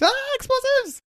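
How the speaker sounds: very high, strained mock scream; cartoonish high-pitched outcry; startled explosives hunter. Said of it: "He sees something, he's".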